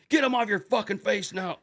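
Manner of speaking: high, loud angry order; furious in-your-face bellow; drill instructor yell. Retold or imitated. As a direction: imitate an instructor shouting a command. Instructor ordering cadet in his face.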